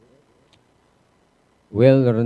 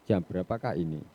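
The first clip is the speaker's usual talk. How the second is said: ordinary talk, nothing marked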